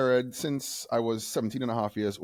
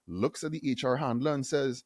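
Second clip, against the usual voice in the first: usual speaking voice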